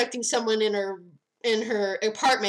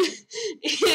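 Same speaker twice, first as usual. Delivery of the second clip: high giggle breaking through; breathy laugh; 8 semitones higher than usual. Real, not acted. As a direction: laugh helplessly mid-story. Laughing retelling the scene.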